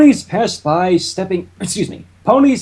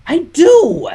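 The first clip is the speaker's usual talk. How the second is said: high, defensive little voice; high Spike; 12 semitones higher, 4 dB louder than usual. Acted. Spike defending himself to Twilight, squeaky. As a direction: protest in a squeaky pitch.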